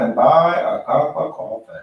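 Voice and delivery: put-on thick New York accent on coffee; exaggerated New Yorker accent. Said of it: "my, uh, real New York accent".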